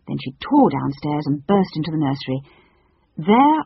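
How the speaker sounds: ordinary delivery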